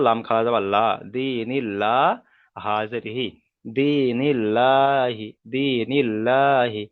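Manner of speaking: slow, measured Arabic letter-by-letter recitation; spelling drill. Shown as a demonstration. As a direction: spell it out deliberately for learners to follow. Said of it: "অনুশীলন করে দিচ্ছি". (ধীরে ধীরে আরবি বানান করে পড়া)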